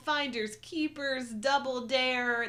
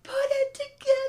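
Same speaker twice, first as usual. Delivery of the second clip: very high, loud frustrated yell; mock kid's scream; 6 dB louder than usual. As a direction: scream it like a kid at the TV. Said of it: "you're, like, screaming as a kid".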